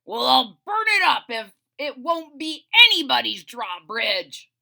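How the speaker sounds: booming threat to burn it; loud dragon threat. Acted. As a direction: bellow the threat at the villagers. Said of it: "said the dragon".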